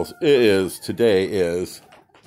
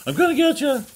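much higher sing-song threat; chase-game teasing, pitched way up; playful taunt; 5 dB louder, faster than usual. Pretend. Teasing the rooster.

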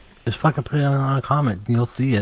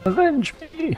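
much higher, hammy dying plea; overacted death scene. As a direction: wail it like a dying hero. Dying teammate's last words.

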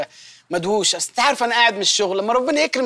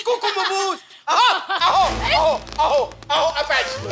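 higher, laughing mock taunt; teasing laugh; 7 semitones higher, slower than usual. Husband mocking wife's pout nickname, laughing taunt. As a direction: chuckle while teasing her.

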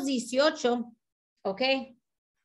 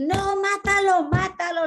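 insistent cry; 9 semitones higher, 5 dB louder than usual. Retold or imitated. Wife insisting to her husband that he kill the centipede, high and loud. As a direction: demand he kill it, no arguing.

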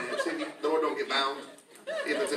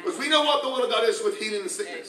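higher, louder revival preaching; full force preaching; 8 semitones higher, 5 dB louder than usual. Acted. Pastor fires up the congregation, preaching louder and higher.

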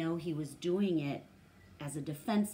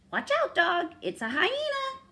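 high, loud yelping warning; yelping dogs; 9 dB louder than usual. Acted. Wild dogs yelping a warning at the pack leader, high and loud. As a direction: yelp it out like a excited wild dog. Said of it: "trailed behind and yelped".

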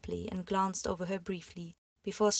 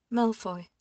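wavering voice; 3 semitones higher, 6 dB louder than usual. Acted. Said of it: "she said again".

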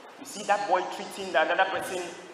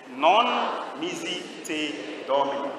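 Latin words drawn out one by one; slow intoned recitation; much slower than usual. Shown as a demonstration. Preacher gives the saint's Latin answer, slow intoned recitation. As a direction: stretch each word out slowly for the congregation. Last slow repetition for the congregation.

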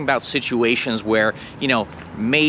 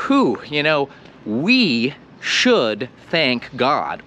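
slow reverent aside; much slower than usual. Shown as a demonstration. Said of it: "what we're saying is".

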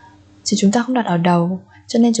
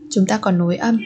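normal talking voice

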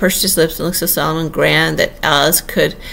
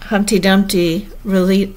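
usual speaking voice

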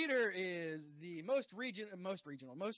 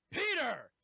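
hyped guest introduction; ring announcer call; 5 semitones higher, 5 dB louder than usual. Acted.

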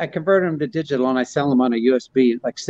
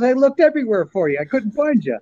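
higher, mock burglar line; burglar punchline voice; 8 semitones higher than usual. Punchline of Rodney's burglar joke, higher mock voice. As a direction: pitch up the thief's complaint. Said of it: "And the guy goes".

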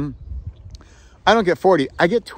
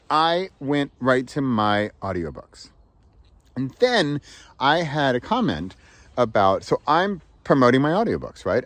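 usual speaking voice

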